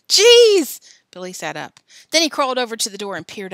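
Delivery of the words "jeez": laughing. Acted. Boys laughing at the prank.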